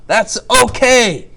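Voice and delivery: high, loud, drawn-out mock outburst; over-the-top booming reassurance; acted exclamation. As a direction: exclaim it slowly, hamming it up. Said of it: "When you're like".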